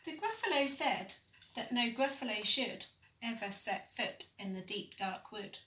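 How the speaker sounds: plain reading voice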